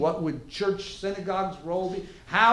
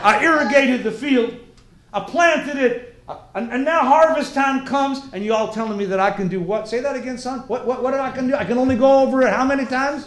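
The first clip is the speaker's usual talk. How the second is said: higher, louder farmer building in disbelief; indignant farmer; 7 semitones higher, 8 dB louder than usual. Acted. Farmer questioning students about harvest law, loud indignation. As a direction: argue like an affronted Texas farmer. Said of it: "Farmer says to you".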